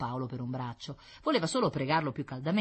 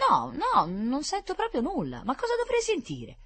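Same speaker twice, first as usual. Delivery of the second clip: put-on puzzled little girl; 13 semitones higher, 5 dB louder than usual. Girl answers doctor.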